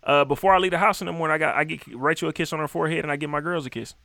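ordinary talk

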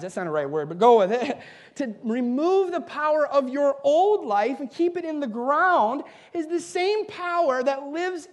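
high, loud preaching at full force; rising sermon fervour; fiery preaching. Acted. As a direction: preach it to the back row.

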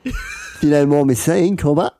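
quick mock Australian, rising tag; Australian accent. Tall man warns fellow passenger about the voyage, Australian accent. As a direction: botch the Scottish into broad Australian. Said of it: "it's gone so Australian".

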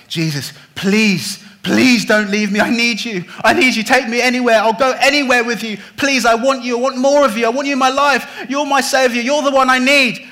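high, loud, pleading cry that builds; desperate begging. Retold or imitated. Freed man clinging to Jesus' knees, begging loudly and high. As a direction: throw yourself at his knees and beg.

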